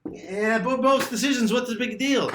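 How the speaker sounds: dismissive student. Retold or imitated. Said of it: "student objecting".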